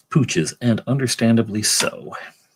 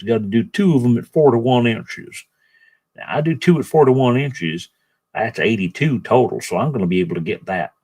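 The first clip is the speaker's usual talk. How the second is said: bad Southern accent. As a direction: talk to yourself in a silly Southern accent. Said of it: "in a bad Southern accent".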